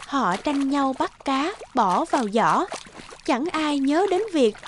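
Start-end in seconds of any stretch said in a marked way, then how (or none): none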